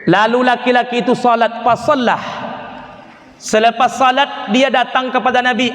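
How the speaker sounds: ordinary delivery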